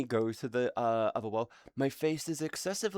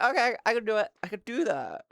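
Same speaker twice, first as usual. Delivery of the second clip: higher, chirpy self-reply; eager reply; 8 semitones higher, faster than usual. Retold or imitated. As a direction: agree brightly like a good kid. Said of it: "And I was like".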